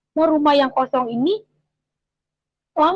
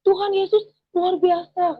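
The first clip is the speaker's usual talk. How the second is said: retold exclamation; 7 semitones higher, slower than usual. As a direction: cry out in praise, slow and high, without real volume. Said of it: "dia teriak".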